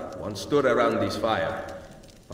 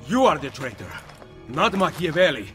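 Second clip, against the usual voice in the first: heated accusation; 6 semitones higher, 3 dB louder than usual. Acted. Accusing the traitor.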